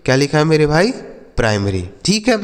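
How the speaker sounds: normal talking voice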